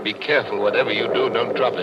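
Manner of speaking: urgent and loud, afraid it breaks; anxious loud warning. Acted. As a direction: warn loudly, nervous. Anxious about fragile record.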